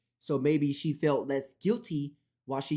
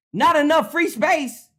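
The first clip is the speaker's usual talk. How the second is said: high, loud exasperation; loud annoyed reading; 11 semitones higher, 8 dB louder than usual. Real, not acted. Reading a storage error aloud, loud and high.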